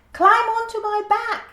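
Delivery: the giant giraffe offering help in a tiny squeak; squeaky giraffe voice. A narrator's reading. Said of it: "he squeaked in his high voice".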